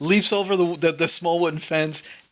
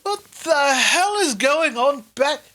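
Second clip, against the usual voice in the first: bewildered Sildar; 9 semitones higher than usual. Acted. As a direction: sputter it out like a baffled adult. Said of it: "And he goes".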